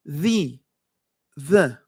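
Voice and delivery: "The" with tongue between the teeth; careful English "the"; interdental TH. Shown as a demonstration. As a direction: model each The apart, voiced TH. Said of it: "Interdental quiere decir".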